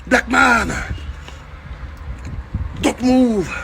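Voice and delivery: high, shouted orders in English; imitated police shout; mock cop yelling. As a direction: bark it like a cop.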